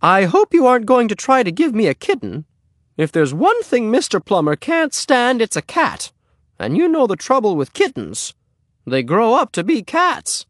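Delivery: high, fussy neighbor-lady voice; Missus Plummer. Acted. Neighbor refuses a kitten from the boy, high and fussy. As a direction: pitch it way up and protest.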